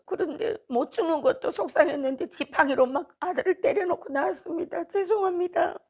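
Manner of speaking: high-pitched pleading to God, climbing toward the apology; retold tearful prayer. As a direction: plead upward as if close to tears. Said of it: "막 울면서 마음속으로". (울먹이듯 높은 목소리로 하나님께 호소)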